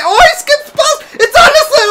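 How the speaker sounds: very high, loud amazed shout; astonished shout. Real, not acted. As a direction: yell in disbelief at the dribble. Keeper dribbles past opponents.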